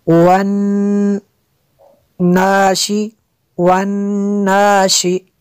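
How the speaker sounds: slow chanted "वन्नाशी" with a held nasal n; ghunnah recitation. Shown as a demonstration. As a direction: hum long on the doubled n in "वन्नाशी". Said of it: "नून में शद्दद है इसलिए गुन्ना किया".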